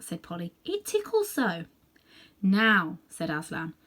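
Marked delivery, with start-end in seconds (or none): 0.6-1.7 put-on girl's voice. Polly giggly complaint. 2.4-3.0 commanding call. Acted. Aslan commands.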